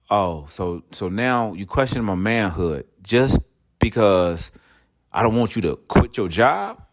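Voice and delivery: ordinary talk, nothing marked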